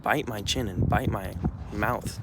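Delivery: normal talking voice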